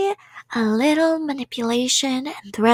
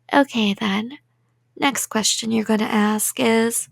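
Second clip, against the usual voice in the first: ordinary delivery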